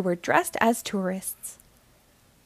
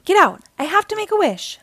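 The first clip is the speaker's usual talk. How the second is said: high, loud urgent shout; a girl shooing her sister to make a wish; 8 semitones higher, 5 dB louder than usual. A narrator's reading. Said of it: "she cried".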